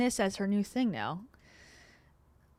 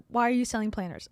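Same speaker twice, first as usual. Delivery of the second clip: ordinary delivery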